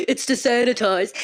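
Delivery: mocking chuckle-voice of a boatman; imitating the boatman. Boatman explaining salt water to hurt rider, mocking imitation. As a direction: mimic a careless laughing explanation. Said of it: "And he's like".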